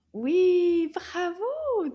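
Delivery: high, loud opening cheer; delighted praise. Real, not acted. Teacher praises class for finding the sound, delighted. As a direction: cheer the right answer with stretched vowels.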